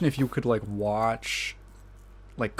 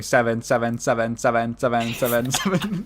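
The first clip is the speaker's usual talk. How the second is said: bad robot reader. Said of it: "it just starts going".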